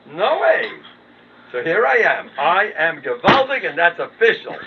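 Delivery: high, loud, slowed boast on gewaldig; boastful proclamation. Acted. Proclaiming his own greatness to the crowd, loud boast. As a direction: crow it like a proud announcement.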